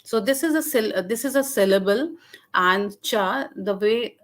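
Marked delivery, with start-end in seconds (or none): none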